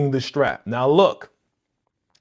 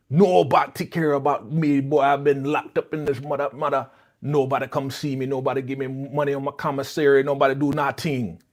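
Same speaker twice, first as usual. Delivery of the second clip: hammed-up Jamaican grievance; terrible Jamaican accent. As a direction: complain bitterly in a bad fake Jamaican accent. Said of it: "Tell me his accent wasn't terrible".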